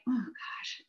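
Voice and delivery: soft, breathy whispered oh gosh; a drained whisper under the breath; whispered sigh. Retold or imitated. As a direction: whisper it like you're worn out. Retelling tired, distraught moment.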